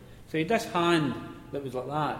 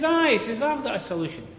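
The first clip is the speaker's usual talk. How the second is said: put-on higher, louder voice of the old miner; cheeky miner voice; 10 semitones higher, 7 dB louder than usual. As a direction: deliver it like a sly pit joker. Angel voiced in story.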